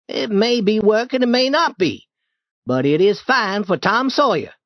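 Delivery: high, proud, quickening at the end; boastful boy Tom. Acted. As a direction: sell the chore as a treat. Tom boasts about painting.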